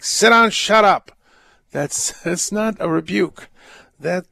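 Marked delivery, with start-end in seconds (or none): 0.0-1.1 loud, raised mock yell. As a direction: bark it out as a command. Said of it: "to yell at someone saying".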